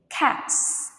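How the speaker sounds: "Cats" ending in a long unvoiced S. Shown as a demonstration. Teacher points out the pronunciation, clear S on "Cats". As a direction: draw attention to the final S of "Cats". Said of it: "S sound pronounce".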